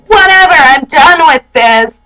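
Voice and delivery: hammed-up huff ending in a grunt; mock exasperation. Girlfriend walking out on boyfriend, mock exasperation. Girlfriend storming out of acted quarrel.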